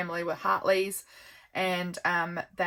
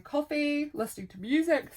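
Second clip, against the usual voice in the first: talking through a yawn; 7 semitones higher than usual. Real, not acted. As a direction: say it mid-yawn, words stretched by the yawn. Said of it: "Sorry, I'm yawning".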